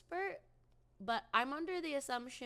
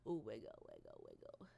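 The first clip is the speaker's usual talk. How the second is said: much lower, softer goofy chant; silly deep voice; 9 dB quieter than usual. Pretend. Streamer goofing for chat, deep silly chant. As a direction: drop way down for the joke.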